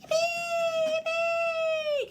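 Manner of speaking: loud, very high squeal; squealing little reindeer's whoop; excited cheer. A narrator's reading. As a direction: shriek it with joy. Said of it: "Can you tell she's excited".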